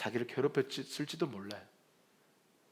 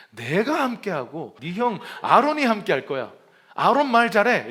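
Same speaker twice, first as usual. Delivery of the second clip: loud, higher scolding; angry God; 6 semitones higher, 8 dB louder than usual. Retold or imitated. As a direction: push the answer at him, annoyed. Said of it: "막 화를 부럭 내시면서". (모세에게 화내며 답하는 하나님, 크게)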